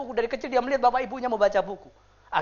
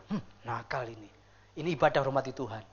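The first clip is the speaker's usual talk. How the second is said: very low, hushed reproach; soft parental scolding; 11 semitones lower, 8 dB quieter than usual. Acted. Parent gently spanking a naughty baby in church, low soft scolding. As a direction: murmur a low telling-off to a baby.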